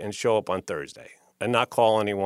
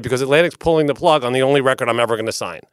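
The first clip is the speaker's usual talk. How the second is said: ordinary talk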